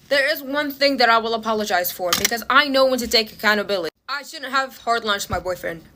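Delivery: quiet, sincere, owning the mistake; sincere apology. Real, not acted. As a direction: drop the rant and apologize earnestly. Apology after the rant.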